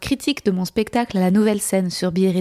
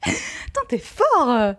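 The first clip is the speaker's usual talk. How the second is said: laughing; 9 semitones higher, much slower than usual. Real, not acted. Laughing at the repeated word.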